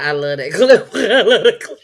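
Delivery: words breaking up with laughter; laughing hard. Real, not acted. Host cracking up after playing the clip, laughing. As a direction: say it helplessly through laughs.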